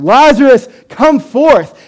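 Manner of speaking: booming, high commanding call; full-voiced shout of command; loud cry. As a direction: cry out to summon him from the tomb. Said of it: "in a loud voice, he cries out".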